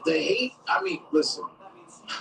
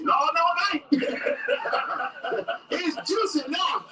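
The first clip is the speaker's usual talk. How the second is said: mocking inmate; 11 semitones higher than usual. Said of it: "He's like".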